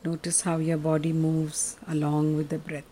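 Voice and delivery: guided meditation. Acted. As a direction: guide them gently into stillness.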